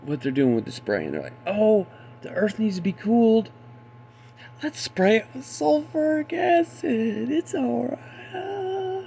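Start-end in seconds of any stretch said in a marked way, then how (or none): 1.5-9.0 high, slow silly mock voice; mock the sprayers as naive kids; parodying officials in a high dopey voice. Mocks officials as silly kid.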